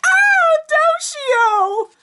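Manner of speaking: put-on very high, loud girly wail; Naka's voice. Naka calling for help again, very high wail. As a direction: cry out helplessly. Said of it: "and Naka's like".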